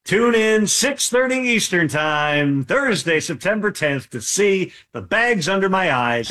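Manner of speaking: mock promo read. Reporter plugging his Thursday game, mock promo read. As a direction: stretch it out like a TV promo. Jokey plug for his broadcast.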